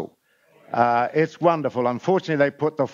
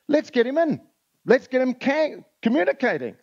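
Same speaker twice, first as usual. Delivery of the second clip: higher, lightly acted Kiwi policeman; 9 semitones higher than usual. Policeman urges his colleagues to fetch the kangaroo whisperer, lightly acted. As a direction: pitch it up as a keen policeman pitching his idea. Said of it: "an expat Kiwi policeman".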